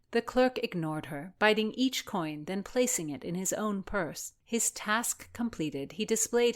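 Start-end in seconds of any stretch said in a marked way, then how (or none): none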